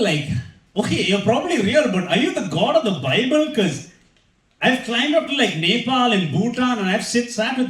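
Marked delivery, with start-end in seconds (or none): none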